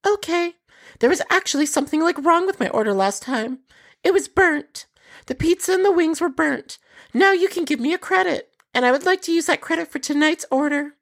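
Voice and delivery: high, put-on voice of a scheming caller; scheming customer. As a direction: play a sly customer fishing for a free credit. Customer voiced by narrator, scam call.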